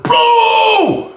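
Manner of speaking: shouted high punch noise. Retold or imitated. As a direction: blast out the sound of the hit. Imitating punch landing again.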